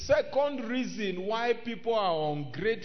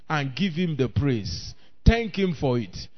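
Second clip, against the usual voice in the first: usual speaking voice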